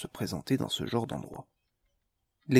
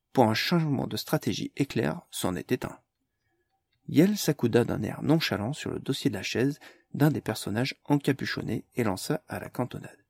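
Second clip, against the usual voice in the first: plain reading voice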